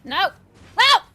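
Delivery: loud panicked no; desperate shouts. Real, not acted. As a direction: yell in desperation. Being attacked in game.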